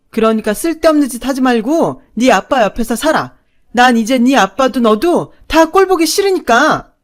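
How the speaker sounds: cruel shouting. Acted. Mother disowns daughter, cruel loud shouting. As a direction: hurl it at her to wound.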